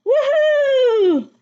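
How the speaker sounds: high, loud, gleeful whoop; wild shout. Acted. Teacher voices the wild kit yelling, very high and loud whoop. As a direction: let out a wild kid's whoop. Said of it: "you yell and scream and shout".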